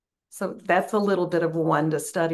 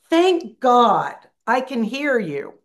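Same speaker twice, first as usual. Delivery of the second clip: put-on relieved old teacher; 6 semitones higher, 8 dB louder, slower than usual. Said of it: "forceful voice".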